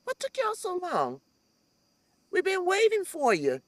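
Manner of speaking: a hint of welcoming elders teasing her lateness; ancestors calling. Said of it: "I used to hear my ancestors say".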